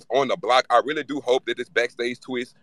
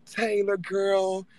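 higher, soft, drawn-out fake country accent; 5 semitones higher, 6 dB quieter, slower than usual. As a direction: put on a slow, syrupy country twang to mock her. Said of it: "with that fake ass country accent".

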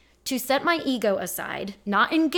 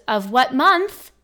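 imitated slow, louder, pointed question; condescending; 3 semitones higher, 8 dB louder, slower than usual. Insurance agent quizzing a caller about a date, irritated and condescending. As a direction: draw it out with exaggerated impatience. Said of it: "That's literally how she said it".

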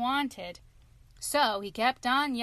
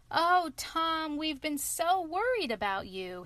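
relieved cry; 6 semitones higher than usual. Acted. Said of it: "his mother cried".

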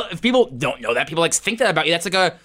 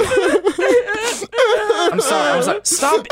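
laughing flustered apology; 13 semitones higher, 3 dB louder, much slower than usual. Real, not acted. Apologizing after hurting friend.

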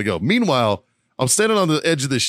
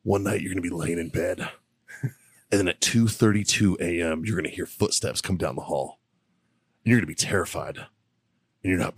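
mock a creep murmuring sweet nothings; imitating a creepy seducer, low and hushed; creepy seductive whisper; 7 semitones lower, 6 dB quieter than usual. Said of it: "He goes".

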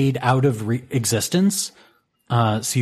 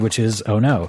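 usual speaking voice

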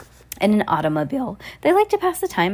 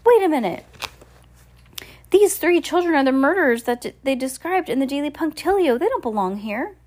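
higher-pitched voice of sudden realisation; interrupt, pitched up, as if exposing someone; sudden objection; 5 semitones higher than usual. Acted. Passenger calling out the children as murderers, sudden objection.

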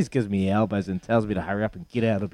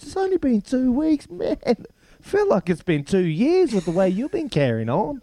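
imitating wife; 11 semitones higher than usual. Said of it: "She goes".

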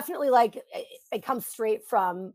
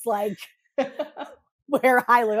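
ordinary talk

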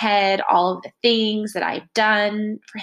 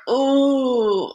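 high, louder sing-song ooh; dramatic ooh; 5 semitones higher, 5 dB louder than usual. Shown as a demonstration. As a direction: swoon it out dramatically. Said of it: "I'm very dramatic".

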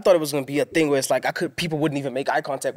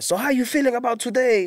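mock interviewer; 11 semitones higher, 3 dB louder than usual. Imitating interviewer with camera.

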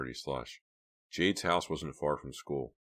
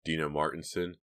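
ordinary delivery

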